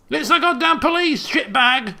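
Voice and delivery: high, loud cop yell; hammed-up yelling into a megaphone. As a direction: bark it through a megaphone. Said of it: "she yells into the megaphone".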